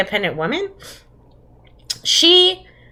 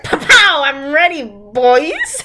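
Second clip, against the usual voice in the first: mock gunslinger; 4 semitones higher, slower than usual. Friend mocking a man with two guns, gleeful gunslinger send-up. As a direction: play a trigger-happy western hero. Mimicking gun-toting western hero.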